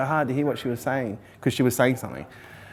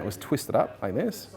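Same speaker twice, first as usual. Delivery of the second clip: ordinary talk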